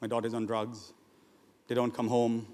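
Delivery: low, soft imitation of lamenting parents; understated, downcast grumble; subdued complaining. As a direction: quietly voice a parent's list of woes. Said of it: "They're always complaining about the children".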